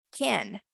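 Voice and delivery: "can" with a flat E-like vowel; American can. Shown as a demonstration. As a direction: say "can" with an E sound, not A. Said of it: "con E".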